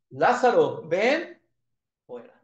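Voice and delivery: Lázaro called out loud, with ven pitched high; loud calling out. Retold or imitated. Jesus calls dead Lazarus out of the tomb, loud commanding call.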